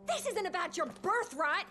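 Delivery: heated scolding. Acted. Doctor berating the heir over his father, angry high rant. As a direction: tear into him for running things like a monarch.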